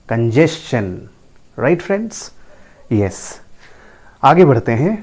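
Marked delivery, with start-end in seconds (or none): 0.1-0.9 congestion repeated as kun-JES-chun; correct pronunciation. Shown as a demonstration. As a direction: clear CH after the S in congestion. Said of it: "the correct pronunciation is". (congestion का सही उच्चारण, कन-जेस-चन)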